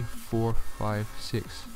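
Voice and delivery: ordinary delivery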